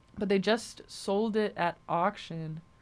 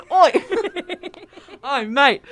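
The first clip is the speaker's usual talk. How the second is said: laughing fake Cockney call, pitched up and loud; bad Cockney accent; 10 semitones higher, 10 dB louder, slower than usual. Host hamming up British slang, laughing Cockney. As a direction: shout it like a cartoon Londoner. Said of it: "That's enough of bad British accents".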